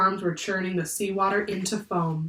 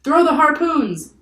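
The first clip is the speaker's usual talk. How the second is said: high, loud, stretched-out command; yelled order; 9 semitones higher, 9 dB louder, slower than usual. A narrator's reading. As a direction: yell it across the deck. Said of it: "yelled a sailor".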